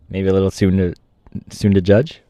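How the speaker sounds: usual speaking voice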